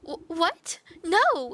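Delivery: high, loud stammered protest; shocked denial. Acted. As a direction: gasp and refuse it outright. Maiden protests the dumping.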